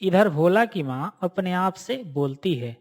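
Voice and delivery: ordinary delivery, nothing marked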